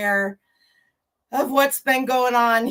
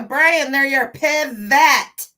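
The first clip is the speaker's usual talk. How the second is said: loud, raised mock yell; playful imitation of a sitcom yell; Ross yelling pivot; 4 semitones higher, 7 dB louder, slower than usual. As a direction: call it out like a catchphrase, loud and high. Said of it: "Ross from Friends yelling".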